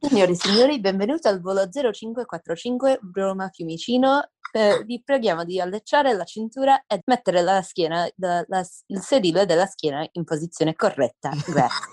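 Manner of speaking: Italian flight attendant's cabin announcement; Alitalia announcement. Shown as a demonstration. Podcast guest performs a boarding welcome on request, Alitalia announcement voice. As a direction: recite it like an Italian airline PA, pitch sinking. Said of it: "do your Alitalia announcement accent".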